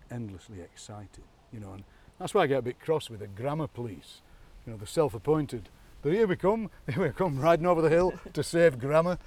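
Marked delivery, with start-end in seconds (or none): 6.0-9.2 louder, higher rallying call; mock-heroic charge to the rescue; mock crusader. Grammar police riding in to rescue language, mock-heroic.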